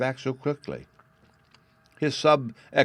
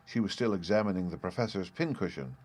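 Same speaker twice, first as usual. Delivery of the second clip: ordinary delivery, nothing marked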